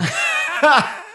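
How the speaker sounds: 2 high burst of real laughter; 3 break out laughing high and loud. Real, not acted. Laughing at the heroin dolphin.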